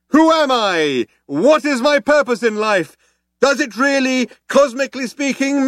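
very high oratorical proclamation, stretching out towards the end; crying out. Acted. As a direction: build a grand speech for the crowd. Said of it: "cried the man".

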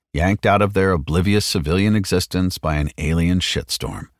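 ordinary delivery, nothing marked

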